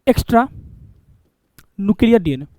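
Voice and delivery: Extra, a pause, then nuclear DNA falling; spaced dictation. Shown as a demonstration. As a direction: dictate it word by word for notes. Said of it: "ये तुम्हारा है".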